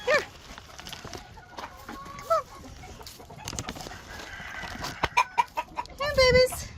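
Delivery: high coaxing call to the hens; calling chickens. Real, not acted.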